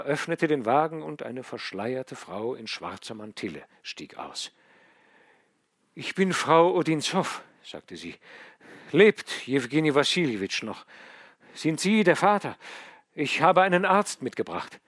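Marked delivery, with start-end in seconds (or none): 5.9-14.8 higher and louder, hurried and anxious; a lady rushing in with a doctor; urgent lady. A narrator's reading. Odinzow arrives.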